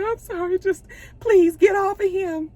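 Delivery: very high-pitched desperate plea; acted pleading. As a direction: beg as if torn and apologetic. Acts out Mississippi pleading with gun.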